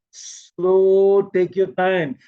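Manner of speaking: SLOW held long and strong; unhurried. Shown as a demonstration. Teacher showing not to rush, SLOW drawn out. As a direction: stretch SLOW right out, then ease on. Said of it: "Can you see how I'm doing it?".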